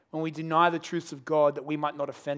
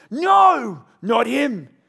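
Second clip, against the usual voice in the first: loud, high rejecting yell; the crowd yelling back at Pilate; crowd shout; 6 semitones higher, 8 dB louder, much slower than usual. Acted. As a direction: shout him down like a mob. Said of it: "They shouted back to him".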